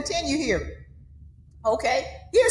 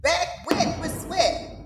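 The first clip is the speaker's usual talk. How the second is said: ordinary delivery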